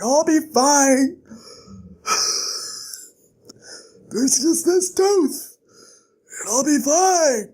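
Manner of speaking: slow, high, strained reassurance; acted hurt, with sighs and pauses; in pain. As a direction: push words out through a sore mouth. Injured Kvothe with bad tooth.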